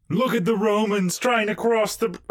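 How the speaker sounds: mocking onlooker.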